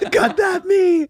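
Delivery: words pushed through high laughter, slow; laughing while talking. Real, not acted. Laughing at his own joke to his wife, high and slow.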